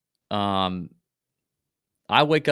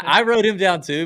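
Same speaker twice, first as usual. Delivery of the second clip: ordinary talk, nothing marked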